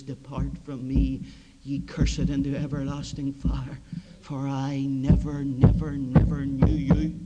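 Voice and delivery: low, slow, grave sentence; solemn judgment. Acted. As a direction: pronounce the verdict heavily. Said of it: "all you will hear".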